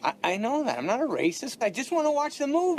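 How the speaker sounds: flustered pleading. Acted. Passenger pleading innocence to the marshal, flustered. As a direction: plead desperately to be believed.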